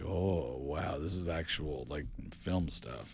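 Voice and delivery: soft, low wonder, slowing at the end; hushed awe. Retold or imitated. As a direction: recall being quietly impressed. Recalls being awed by director's work.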